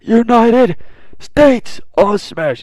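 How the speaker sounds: hammed-up hero battle cry; all-out attack shout. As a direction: scream the move past his limit. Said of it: "He's like".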